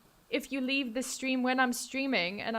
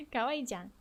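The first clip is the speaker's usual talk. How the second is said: lightly cutesy anime voice; 6 dB quieter than usual. Shown as a demonstration. Streamer demonstrating cute anime voice, cute voice. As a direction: softly put on a cute voice. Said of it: "I can make my voice cute".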